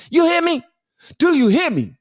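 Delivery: a mock lawyer pressing his witness; stern demand. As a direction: lean on him, make him answer. Lawyer threatening his client.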